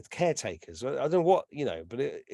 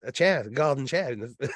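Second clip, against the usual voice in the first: ordinary talk, nothing marked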